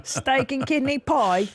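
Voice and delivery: slow, laughing mock Brit order; lightly put-on cockney; mock English accent. Wife imitating his Starbucks order in a slow mock accent. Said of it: "that accent".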